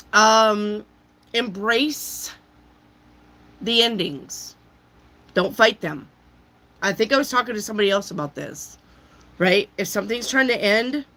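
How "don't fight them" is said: normal talking voice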